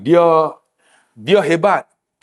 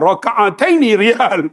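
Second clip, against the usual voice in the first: slow, deliberate, self-assured; proud mock-confident reply; 3 semitones higher, slower than usual. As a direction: lay it down firmly and slowly. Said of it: "Dengan confidentnya".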